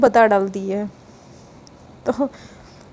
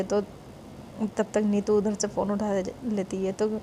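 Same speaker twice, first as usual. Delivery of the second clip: ordinary delivery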